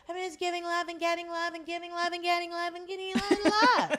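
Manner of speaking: high singsong mockery, running on and on; mocking prayer. Mocking her prayer about love, high and hammed-up. Mocks the character's repetitive prayer.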